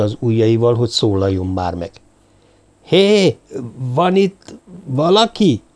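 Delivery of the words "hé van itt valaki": high, drawn-out calling from the door; cautious call. A narrator's reading. Same unseen visitor calling in again, cautious. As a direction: call out warily, slowing on the question. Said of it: "kérdezte az előbbi óvatos hang".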